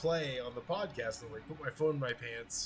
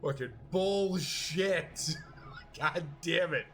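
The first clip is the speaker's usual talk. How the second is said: mock outrage; 4 semitones higher, 8 dB louder, slower than usual. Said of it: "both came to the realization".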